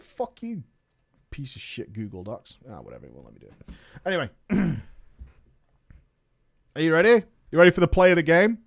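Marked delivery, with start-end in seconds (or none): 0.0-3.7 fast, very soft mutter; real annoyed mumble; muttered aside. Real, not acted. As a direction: grumble it under your breath.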